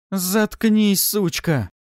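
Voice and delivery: high, loud furious outburst; enraged. A narrator's reading. Kastala silencing Pafi's insults, enraged. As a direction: cut her off in high, loud fury. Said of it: "она реально взбесилась".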